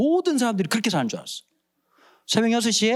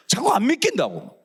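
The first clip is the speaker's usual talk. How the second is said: ordinary talk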